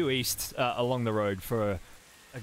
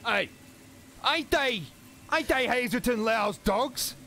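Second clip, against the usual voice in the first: jeering mob member; 12 semitones higher, slower than usual. Acted. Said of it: "And it's like".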